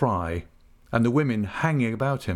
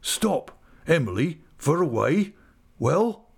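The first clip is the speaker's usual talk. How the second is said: higher, halting questioning voice; dazed countryman, pitched up; stunned rustic uncle; 6 semitones higher, slower than usual. Acted. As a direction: break in, struggling to take it in. Stunned uncle interrupts the letter.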